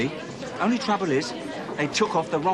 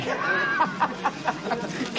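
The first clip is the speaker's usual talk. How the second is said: laughs at own joke; 8 semitones higher than usual. Acted. Reg laughing at his own joke.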